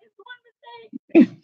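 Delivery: put-on high, slow, sheepish child voice; embarrassed kid. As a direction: plead like a mortified kid. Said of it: "She's so embarrassed".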